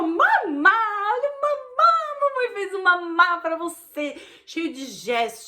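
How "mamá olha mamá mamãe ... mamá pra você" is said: high, slow, sing-song "Mamá, olha, mamá" bursting with joy; exaggerated baby-talk delight, higher and louder, stretched out; joyful, full of intonation. Shown as a demonstration. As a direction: gush over the baby's bottle like a thrilled mother, pitch soaring on "olha, mamá". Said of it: "cheio de entonação, cheio de alegria".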